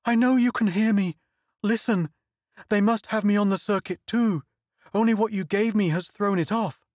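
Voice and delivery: high, urgent, fearful pleading; desperate pleading. Acted. Donna pleads with Kim on the circuit, high desperate urgency. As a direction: beg him to listen before it's too late. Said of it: "she cried desperately".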